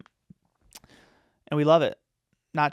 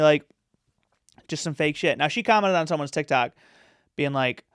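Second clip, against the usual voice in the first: ordinary talk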